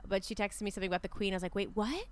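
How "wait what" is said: rising, higher-pitched startled questions; replaying a startled double take; retold alarm. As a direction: react with alarmed confusion, retold. Retold alarmed reaction to news.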